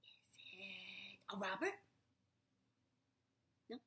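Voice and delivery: hushed, secretive whisper; breathy conspiratorial whisper. Real, not acted. Whispering to child during story.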